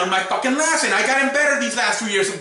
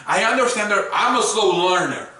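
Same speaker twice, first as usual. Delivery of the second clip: normal talking voice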